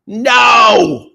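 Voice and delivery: loud, high shout of No; a loud refusal of doing softball crap; emphatic shout. Real, not acted. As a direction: bark it out, refusing outright. Rejecting softball interviews.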